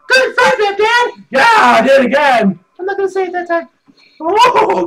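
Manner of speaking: put-on very high, loud childish cheering; excited kid. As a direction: squeal like a thrilled little boy. Son cheers dad on.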